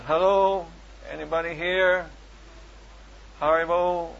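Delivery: loud call into an empty shop. Retold or imitated. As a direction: call out to find someone. Calling out in empty garage.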